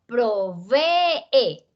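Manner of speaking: "Provee" with the final e as its own syllable; split syllables. Shown as a demonstration. Teacher showing vowels opening into two syllables, split slowly. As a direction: stretch out "Provee" as pro, ve, e. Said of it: "se abren en dos sílabas".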